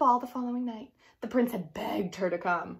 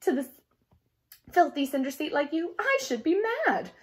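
haughty stepsister; 5 semitones higher, 5 dB louder than usual. Acted. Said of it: "said Javette".

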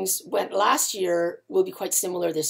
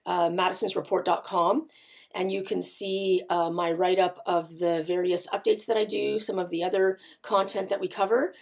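ordinary talk, nothing marked

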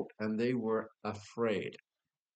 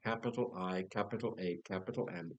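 ordinary delivery

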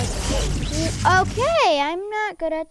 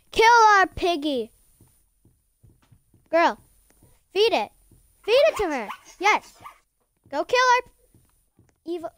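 shouting; 4 semitones higher, 5 dB louder than usual. Real, not acted. Shouting orders at teammates.

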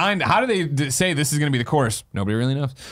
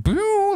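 laser pew. Retold or imitated. Imitating laser sound.

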